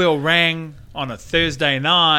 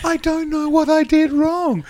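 higher, slow innocent voice; 10 semitones higher, 3 dB louder than usual. Pretend. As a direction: play the wounded pop star. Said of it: "[name] has said".

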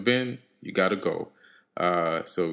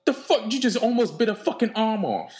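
scolding; 7 semitones higher than usual. Acted. Scolds dragon for biting.

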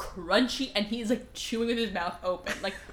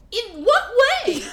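incredulous; 12 semitones higher, 8 dB louder than usual. Retold or imitated. Incredulous question.

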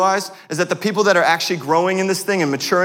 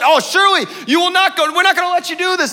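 pleading disciples; 4 dB louder than usual. Acted.

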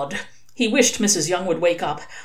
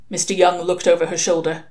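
usual speaking voice

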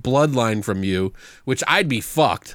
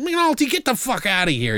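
high-pitched acted annoyance; mock exasperated order; 10 semitones higher than usual. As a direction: shoo him out in exasperation. Acted character throwing McNulty out.

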